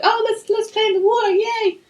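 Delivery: squealy high-pitched mock girly voice; mock ditzy girls. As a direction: squeal it like giddy airheads. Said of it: "Lucy Liu and Cameron Diaz are like".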